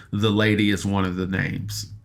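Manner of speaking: usual speaking voice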